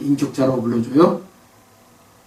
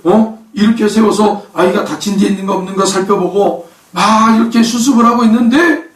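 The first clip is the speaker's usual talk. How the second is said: normal talking voice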